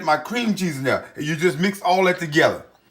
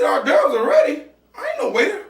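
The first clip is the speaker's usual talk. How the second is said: offended, high and incredulous; affronted retort, pitched up; indignant; 7 semitones higher than usual. Retold or imitated.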